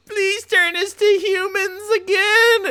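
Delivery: very high, loud, slow wailing plea from a tiny frog; crying frog plea. Acted. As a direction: beg in a squeaky high sob as a cursed frog. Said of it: "And they're, like, crying".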